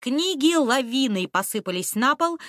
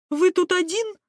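stern teacher; 10 semitones higher, 5 dB louder than usual. A narrator's reading. Teacher questions student.

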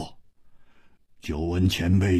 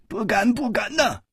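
high-voiced elder waving off praise; high old man's voice, politely humble; modest old man; 11 semitones higher than usual. A narrator's reading. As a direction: demur as a genial old-timer.

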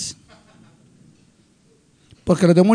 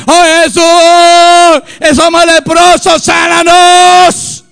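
long, high shouted plea; crying out; 8 semitones higher, 7 dB louder, much slower than usual. Acted. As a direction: cry out to Jesus as the lepers, stretching the words. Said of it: "comenzaron a gritarle a Jesús".

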